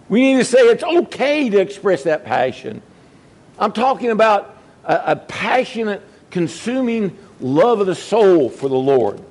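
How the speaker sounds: high, rising preacher's appeal; preacher pleading with the congregation to keep their first love, fervent; fervent preaching. Acted. Preacher stirs up passion.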